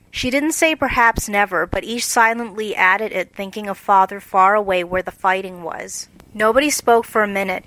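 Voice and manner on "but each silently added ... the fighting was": ordinary delivery, nothing marked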